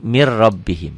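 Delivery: low "mir rabbihim" with a doubled R; N left unread. Shown as a demonstration. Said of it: "setiap warna orang jangan dibaca".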